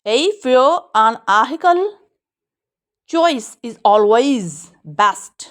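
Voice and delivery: very slow, each word drawn out; slow model reading. Shown as a demonstration. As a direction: read the sentence slowly for the class. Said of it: "model sentence read".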